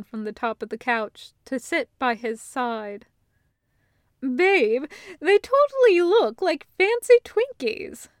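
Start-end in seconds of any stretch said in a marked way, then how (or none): 4.2-8.1 higher and louder, delighted; gushing over a treat; a burst of girlish glee; excited Loop. A narrator's reading. Loop excited about cakes.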